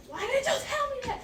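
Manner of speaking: high, incredulous question; surprised. Real, not acted. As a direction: protest in disbelief. Surprised protest.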